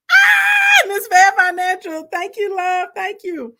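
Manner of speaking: high, loud squeal easing into thanks; excited thank-you. Real, not acted. Storyteller breaks off to thank a supporter, excited high squeal. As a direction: burst out high, then settle into thanks.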